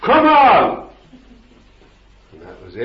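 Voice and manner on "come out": commanding shout. Retold or imitated. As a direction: bellow the command at full force. Preacher commanding demon out of woman.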